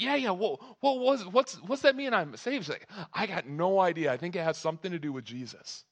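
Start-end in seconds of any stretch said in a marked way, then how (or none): 0.0-2.6 high-pitched, urgent questioning; excited, puzzled boy. Acted. Son asking mom about dad's shouting, high and bewildered. 3.1-5.8 baffled mom. Acted. Acted mom's baffled answer.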